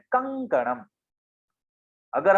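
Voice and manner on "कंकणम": कंकणम with a ङ sound before क; word demo. Shown as a demonstration. Teacher showing students the nasal in कंकणम. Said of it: "उसका हम उच्चारण कर रहे हैं".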